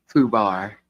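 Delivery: ordinary talk, nothing marked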